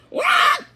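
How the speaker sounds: high loud startled squawk; sudden high squawk; chick squawk. A narrator's reading. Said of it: "a loud squawk startled me".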